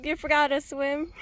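usual speaking voice

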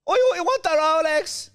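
very high, loud Irish robber; put-on Irish falsetto, shouted; high-pitched Irishman. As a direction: demand it in a squealing Irish disguise voice. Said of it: "high-pitched Irishman".

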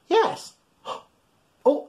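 plain reading voice

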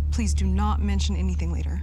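loud, pressing plea; urgent plea. Acted. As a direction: insist loudly, almost begging.

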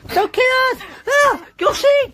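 very high, loud pleading shriek; terrified scream. Acted. Captive begging Bowser not to kill them, terrified scream. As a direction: beg for your life at full pitch. Victim begs villain for life.

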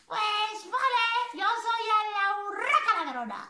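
high, loud chirpy bird voice; high magpie voice. Acted. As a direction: announce yourself as a cheeky magpie. Magpie introduces herself.

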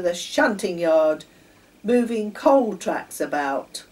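a hint of a stern station master. A narrator's reading. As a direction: lay down the law firmly. Station master lectures driver and Sammy.

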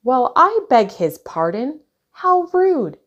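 high, loud, affronted outburst; indignant cry. A narrator's reading. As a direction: protest as if deeply insulted, pitch climbing. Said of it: "cried Bridget".

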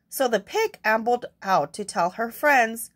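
ordinary delivery

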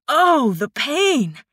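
theatrical fake agony; dramatic mock suffering. A narrator's reading. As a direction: ham it up like a tragic hero. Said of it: "draping his arm across his forehead dramatically".

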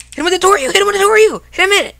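high, urgent combat callouts; urgent excited calls. Real, not acted. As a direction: call the moves out in a rising frenzy. Urgent combo calls mid-fight.

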